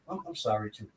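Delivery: low, soft, slightly stammered sorry; meek stuttered apology. Retold or imitated. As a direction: imitate a subdued apology. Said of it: "He said".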